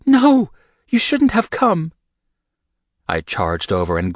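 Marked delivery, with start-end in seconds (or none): 0.0-1.9 high, loud frantic female voice; Rika, panicked. A narrator's reading. Rika begs Michael to leave, high and frantic.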